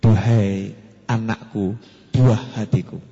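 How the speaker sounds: slow, low and tender; tender address. Shown as a demonstration. Father lovingly addresses his child, slow and low. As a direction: speak slowly like a loving father. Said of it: "manggil anak sing halus".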